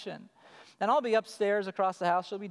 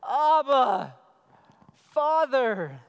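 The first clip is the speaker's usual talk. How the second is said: loud, raised call to a father; crying out; 4 semitones higher, 8 dB louder, much slower than usual. Acted. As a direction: cry it out to the Father. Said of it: "by whom we cry".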